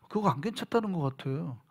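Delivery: soft, hushed doubt; quiet doubtful remark. Retold or imitated. Quietly concluding. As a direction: pastor telling his friend the member was not fine, softly. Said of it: "라고 내가 얘기를 한 거예요".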